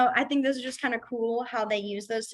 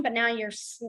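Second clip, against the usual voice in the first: ordinary delivery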